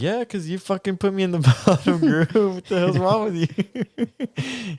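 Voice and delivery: high mock-angry rant, slowing to the end. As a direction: ham up a sulky outburst at the teacher. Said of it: "Benito got mad at him".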